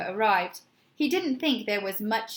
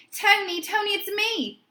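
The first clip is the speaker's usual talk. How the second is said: yelled; 9 semitones higher than usual. A narrator's reading. Mister Music yelling toward the phone on the floor. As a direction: project it down to the floor. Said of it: "he yelled".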